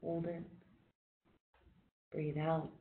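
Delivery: soft, calm breathing cues with long pauses; quiet meditation guide counting breaths; guided breathing. Acted. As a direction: ease the group through each breath, gently. Leads a guided breathing exercise.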